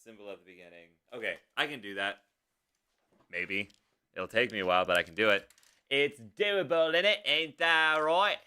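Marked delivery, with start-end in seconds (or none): 5.9-8.4 playful put-on British accent, slow; mock British accent. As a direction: ham up a British accent on "innit". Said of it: "I keep turning British".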